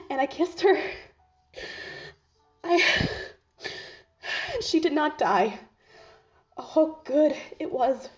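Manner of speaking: overwhelmed rapture. Acted. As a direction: gasp out the memory, overcome. Recalls his first kiss, overcome.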